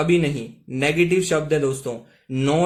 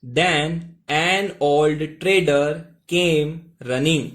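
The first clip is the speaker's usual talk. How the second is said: very slow, word by word English; slow model reading; much slower than usual. Shown as a demonstration. Teacher to students, reading the next sentence very slowly. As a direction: read the new line slowly so they can follow. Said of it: "आगे देखिएगा".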